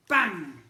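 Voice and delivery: very loud bang; gun bang. A narrator's reading. Narrator voicing the potato gun. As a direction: fire it off loud.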